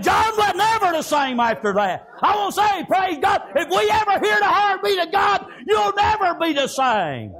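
high, driving sermon cadence; full-force preaching. Real, not acted. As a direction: preach it hard, phrase after phrase.

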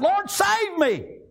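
high, drawn-out plea; desperate cry for help. Retold or imitated. Peter sinking in the water calls to the Lord, desperate cry.

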